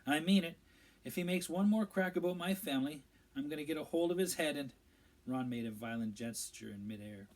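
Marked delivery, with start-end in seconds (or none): 0.0-4.7 louder, rising angry rant. A narrator's reading. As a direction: threaten through clenched anger, speeding up. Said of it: "he said angrily".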